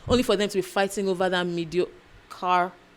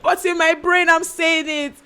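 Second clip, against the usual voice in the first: Igbo-accented patois attempt; 9 semitones higher than usual. Shown as a demonstration. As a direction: shout it out high in a failed patois. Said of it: "don't let your Igbo accent come out".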